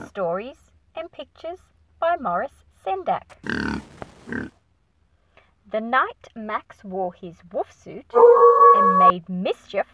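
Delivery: ordinary delivery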